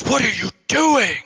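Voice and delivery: outraged high-pitched yell; over-the-top outraged cry, pitch leaping up; Jesse impression. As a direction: exclaim it loud and high, in shocked protest. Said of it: "he goes".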